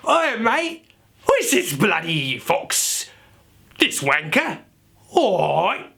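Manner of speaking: hammed-up, drawn-out British geezer, high voice; British accent. Shown as a demonstration. Accent teacher trying the whole sentence, exaggerated British. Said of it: "you would say".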